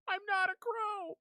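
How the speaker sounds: very high indignant squeal; shrill protest. Real, not acted. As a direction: squeal the denial, mock offended. Protesting chat again.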